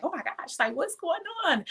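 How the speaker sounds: high, startled oh my gosh; panicked disbelief. Retold or imitated. Telling listeners how the middle of the struggle felt, startled high voice. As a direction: voice jumping up in alarm.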